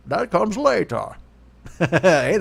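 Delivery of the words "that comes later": Bane voice. Pretend. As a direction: do Tom Hardy's Bane, menacing and slow. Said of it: "I'd have him talk to me in the Bane voice".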